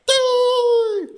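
very high squeaky hoot; high mock hoot. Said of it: "everyone's like".

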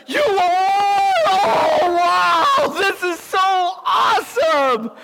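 put-on overjoyed like a kid picked by a star; starstruck excitement. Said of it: "I'm gonna be your quarterback".

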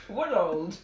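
"World" said as one short syllable. Shown as a demonstration. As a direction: clip "World" to one beat. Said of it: "mine's only got one".

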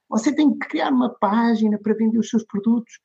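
lightly voiced pitch to a client; sales pitch.

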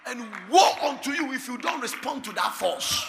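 loud, rising preacher shout; shouted preaching. Acted. Preacher warning the congregation, loud full-force shout. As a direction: drive it home to the crowd.

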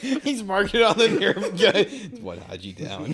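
high, loud laugh-filled quip. Real, not acted. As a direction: crack the joke mid-laugh. Joking while laughing.

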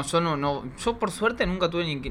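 ordinary talk, nothing marked

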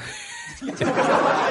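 short breathy giggle; amused little laugh. Real, not acted. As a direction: let out a quick high chuckle. Laughs after admitting opinion.

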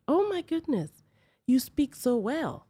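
high, gushing mock amazement; condescending. Store lady praising African husband's English, condescending. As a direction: imitate patronizing praise, slowing right down on it. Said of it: "sound condescending".

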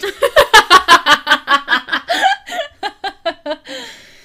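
big burst of laughter; loud laugh. Real, not acted. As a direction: howl with laughter. Friend's joke lands.

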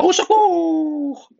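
very high, loud, drawn-out squeal for help; cried out. A narrator's reading. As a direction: scream for help as the panicked fox. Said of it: "il cria".

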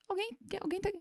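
high, very soft mock call; feigned searching. Mother ignoring a crying child, high soft pretend question. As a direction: pretend to look for someone, high and hushed.

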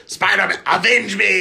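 loud high dying cry; overacted death scene. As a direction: ham it up like a dying hero. Acting Spider-Man movie death scene.

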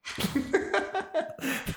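high-pitched giggling fit; laughing. Real, not acted. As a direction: laugh at your own silly impression. Laughing at own imitation. (risa aguda)

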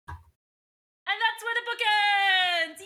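high, loud, slow triumphant reveal; excited announcement. Real, not acted. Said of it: "book finally over".

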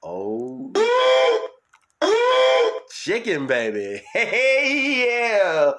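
high loud whooping; excited whoop. Real, not acted. Host hollering about chicken to listeners, high and loud. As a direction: hoot it out with delight. Cheering over food.

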